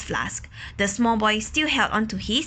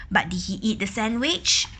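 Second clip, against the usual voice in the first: ordinary delivery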